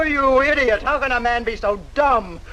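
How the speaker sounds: ordinary talk, nothing marked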